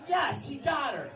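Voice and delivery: high shouted cheer; gleeful cheer. Retold or imitated. Comic cheers the headliner's comeback, high shout. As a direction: cheer like a fan. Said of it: "I'm like".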